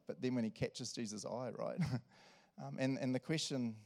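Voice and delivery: ordinary delivery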